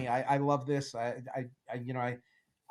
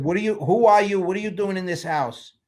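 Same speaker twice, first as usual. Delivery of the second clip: loud, raised-pitch demand; mock confrontation; 5 semitones higher, 7 dB louder than usual. Boss challenges helper he pretends not to know, loud and indignant. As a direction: bluster at him like he's an intruder.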